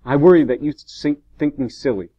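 harsh and upset, hitting the opening hard. A narrator's reading. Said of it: "I said harshly".

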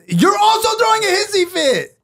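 high, loud, exasperated yelling; angry shout. Real, not acted. Arguing with her cohost, shouting high and loud. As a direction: shout back at him in frustration. Heated argument, shouting back.

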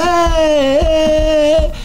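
high, stretched-out chanting holler; high singsong hype yell; imitated crowd yell. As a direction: mimic a rowdy room cheering along to a beat. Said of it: "everybody yelling".

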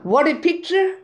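ordinary delivery

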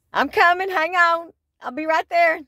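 high, loud mock holler; playful calling out. Calling to the men at AutoZone, playful high holler. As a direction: holler ahead as if rushing over.

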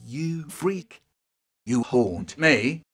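ordinary talk

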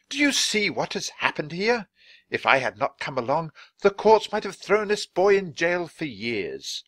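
high, loud oratory to a crowd; indignant Dickens. Acted. Dickens rebukes the crowd over a poor boy, indignant.

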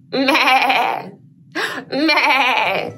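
giggly goat bleat; goat bleat with a laugh. Shown as a demonstration. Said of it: "Let's meh like goats together".

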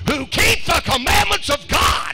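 high, shouted sermon climax; preacher at full cry, pitch way up; full-force preaching. Real, not acted. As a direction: shout it out to the whole congregation.

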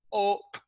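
"Hope" broken into h, o, p; three separate sounds. Shown as a demonstration. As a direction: sound out "Hope" slowly, a puff of breath first. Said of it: "they repeat three sounds".

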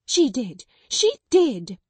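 hysterical cry. Acted. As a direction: cry it out, losing control. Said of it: "she cried".

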